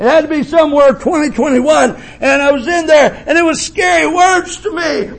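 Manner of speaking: high, ringing preacher's cry; preaching at full force. Acted. Preacher tells the church of his mother, full-force preaching. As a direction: preach it out to the congregation.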